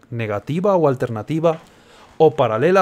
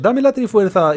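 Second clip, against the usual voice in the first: demanding villain; 7 semitones higher, 4 dB louder, slower than usual. Retold or imitated. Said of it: "Tú le dices".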